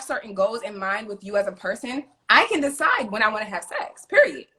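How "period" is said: PERIOD punched high and loud; emphatic period. Real, not acted. To the man judging her, a loud final period. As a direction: slam the word period down.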